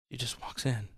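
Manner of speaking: soft whispered reveal. Acted. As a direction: let it drop to a whisper. Whispered climax.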